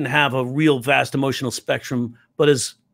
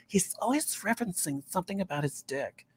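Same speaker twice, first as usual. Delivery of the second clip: put-on judgy older woman; 5 semitones higher, 12 dB quieter than usual. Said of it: "So I'd be like".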